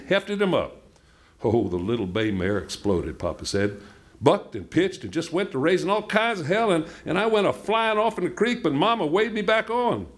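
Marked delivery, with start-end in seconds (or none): 4.2-10.1 high, excited retelling; breathless-paced yarn climbing high in pitch; excited storytelling. A narrator's reading. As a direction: tell the wild ride in a raised, eager pitch. Papa retells a wild bucking ride.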